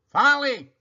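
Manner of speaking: put-on slow, loud haughty old woman voice; stern sister. A narrator's reading. Eugenia scolds.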